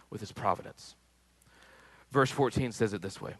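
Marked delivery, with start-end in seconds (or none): 0.1-1.0 a quiet reflective echo of "with his providence"; soft echo. Real, not acted. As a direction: repeat the phrase much softer, almost to yourself. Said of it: "God answers that with his providence".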